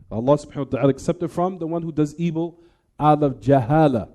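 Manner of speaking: ordinary talk, nothing marked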